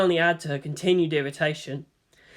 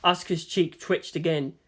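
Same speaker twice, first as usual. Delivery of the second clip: ordinary delivery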